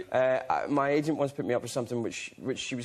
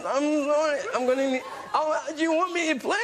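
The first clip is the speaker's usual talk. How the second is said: high, loud fake crying, laughing through it; hammed-up fake crying with a break in the voice; mock sobbing plea; 11 semitones higher, 3 dB louder than usual. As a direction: ham up a teary excuse, voice up high and breaking with laughter. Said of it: "I made myself cry".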